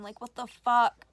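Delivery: loud, drawn-out what the fuck; exasperated outburst. Real, not acted. Reader outraged at the male lead, loud. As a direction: let the outrage burst out.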